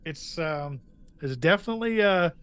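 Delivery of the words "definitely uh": delighted amazement. Real, not acted. Player amazed by the twist.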